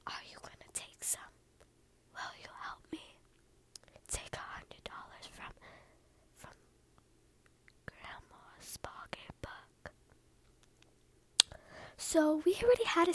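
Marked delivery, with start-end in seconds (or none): 0.1-9.9 hushed, secretive whisper; soft whisper. Retold or imitated. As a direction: whisper a sneaky request for help. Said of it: "you know, softly whispered".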